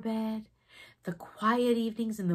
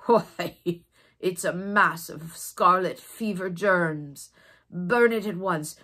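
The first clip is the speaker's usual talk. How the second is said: stern doctor; 2 semitones lower, 6 dB louder, slower than usual. Acted. Doctor orders the toy burnt.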